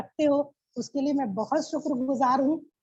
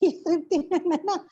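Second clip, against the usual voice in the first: higher, eager yes with a laugh; laughing recognition; 4 semitones higher than usual. Retold or imitated. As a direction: laugh as you recognise her. (हँसते हुए, उत्साह से पहचानना)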